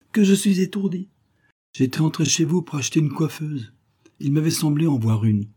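high-pitched self-reproach, easing down; client's sudden afterthought. Acted. Departing client turns back to the shopkeeper, suddenly remembering. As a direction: exclaim as if just remembering.

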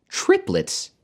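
word thrown up high in exclamation; exclaiming. A narrator's reading. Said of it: "She was exclaiming over".

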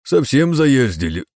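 loud hammed-up grumble; mock complaint. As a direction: complain theatrically. (наигранная жалоба)

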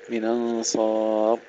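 slow "من أنصار" joined as minansar, no hamza; naql. Shown as a demonstration. Teacher shows Khalaf's first option, naql. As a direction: glide from "من" into "أنصار" without a glottal stop. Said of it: "له النقل والتحقيق والسكت".

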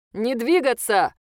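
slow, loud robotic command; drone loudspeaker. Acted. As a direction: bark it through a speaker. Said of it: "прозвучал из динамика ближайшего дрона".

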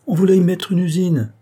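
pointed warning; emphatic revelation. Acted. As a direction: drop the bombshell. Uncle reveals the factory plan.

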